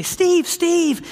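imitating clamoring friends; excited friends' request. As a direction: call out to a friend to do his trick. Friends calling out requests for impressions.

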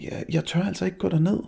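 scared stammer. Acted. Scared boy whispers at night.

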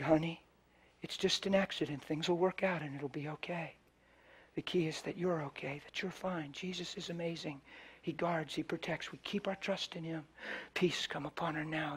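soft hushed prayer; whispered comfort. Retold or imitated. Preacher whispering comfort and prayer over the girl. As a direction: whisper peace over her. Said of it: "And I whispered".

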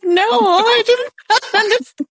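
very high, slow, whiny mock sob with a laugh breaking through; hammed-up crying student insisting; mock tearful protest. Student in tears telling the teacher it's no boy, high mock wail. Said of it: "I'm like".

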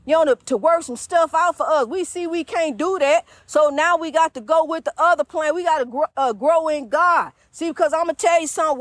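normal talking voice

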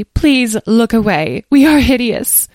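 high, slow fairy lament; a touch of theatrical misery, loud and high; sad. A narrator's reading. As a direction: wail it lightly, drawn out. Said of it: "said one sadly".

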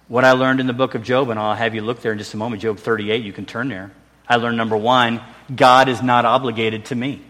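ordinary talk, nothing marked

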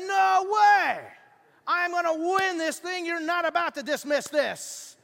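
high, loud furious yelling; enraged shout. Retold or imitated. Man yelling at the judge who dismissed his case, enraged shout. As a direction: roar your outrage at the judge. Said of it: "He said he was enraged".

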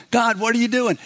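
lightly put-on whining complaint. Preacher imitating the Israelites complaining to God, whining. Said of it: "They start whining and going".

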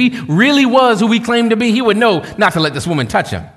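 mock the Pharisee's snide aside; sneering Pharisee. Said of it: "looked at Jesus and said".

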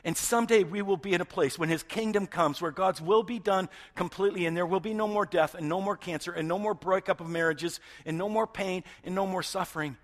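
loud, high preaching; full-voiced pulpit preaching.

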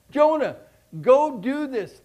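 calling loud and high, slow command; voice of God. Retold or imitated. God calling on Jonah to go, loud command. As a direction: command the prophet from above. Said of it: "he's asking Jonah".

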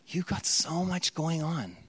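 ordinary delivery

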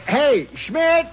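high call across the yard; calling to a man across the field. Acted. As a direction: hail him from a distance.

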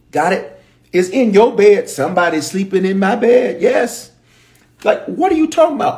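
high-pitched, exasperated disbelief; incredulous outburst. Acted. Objecting to David mourning the man who took his kingdom, incredulous. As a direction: confront him with mounting indignation.

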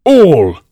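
"All" drawn high with weight; great emphasis. A narrator's reading. King looks hard at Alice, stressing "All" emphatically. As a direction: hit "All" hard as a lone word. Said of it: "he repeated with great emphasis".

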